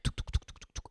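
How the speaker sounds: soft unvoiced touc touc engine sputter; plane engine imitation. Telling a friend about the skydiving plane, soft engine noise. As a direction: mimic a small plane's motor, breathy and quiet.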